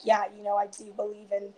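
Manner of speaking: ordinary talk, nothing marked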